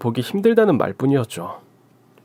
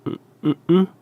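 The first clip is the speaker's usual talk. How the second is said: a quick, higher run of surprised questions; startled; 6 semitones higher, faster than usual. Acted. Father, startled as his little daughter calls him. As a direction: caught off guard, voice jumping up.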